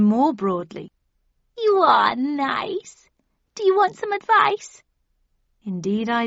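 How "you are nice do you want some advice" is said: high, slow, purring cat voice; a sly grinning cat, drawn out; Cheshire Cat. Acted.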